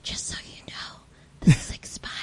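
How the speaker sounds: hushed, breathy whisper to the cashier; a discreet whispered heads-up; whispered aside. Retold or imitated. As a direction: lean in and whisper the warning. Quietly telling cashier about expired snacks.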